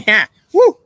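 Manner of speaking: loud, high whoop of glee; gleeful whoop. Acted. Talking to himself about being bad, a loud high whoop. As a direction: cheer yourself on, loud and high.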